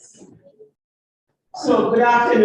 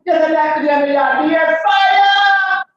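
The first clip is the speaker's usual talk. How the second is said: high, loud cries of fire; frantic shouting; shouted alarm; 9 semitones higher, 5 dB louder than usual. Acted. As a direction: yell it out in panic.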